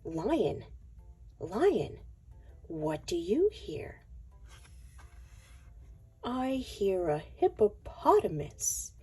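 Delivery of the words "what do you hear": plain reading voice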